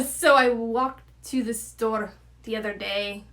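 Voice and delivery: accent attempt landing in Irish; South African attempt turning Irish. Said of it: "and that was Irish".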